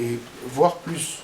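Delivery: ordinary delivery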